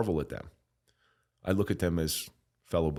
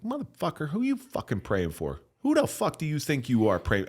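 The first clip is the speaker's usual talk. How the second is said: acting out an outraged rant, pitch jumping high; retold fury; 7 semitones higher than usual. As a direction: re-enact an offended, incredulous outburst. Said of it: "I'm, I'm mad. Like, I'm fucking furious".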